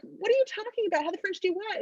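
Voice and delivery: can't believe what she's saying; baffled teens. Retold or imitated. Everybody asking Amber what she means, baffled. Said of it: "everybody's like".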